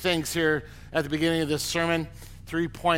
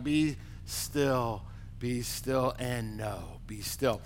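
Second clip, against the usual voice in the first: from soft down to very soft and lower, "be still" repeated; fading hush; 6 semitones lower, 7 dB quieter, slower than usual. Shown as a demonstration. Preacher calming the church, softening to near silence. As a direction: let "be still" sink lower and softer each time.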